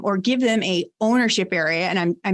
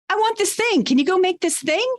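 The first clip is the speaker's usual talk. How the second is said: much higher-pitched, eager-sounding request; visionary demand imitated; 9 semitones higher than usual. Visionary boss handing off an idea, high-pitched mock request. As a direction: act out an impulsive boss, voice pitched up.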